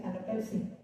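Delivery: "Pepsi" with k in place of the second p; soft demo of "Pepsi" said pek-si; mispronounced Pepsi. Shown as a demonstration. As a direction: say "Pepsi" as peksi. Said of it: "¿cómo lo dice?".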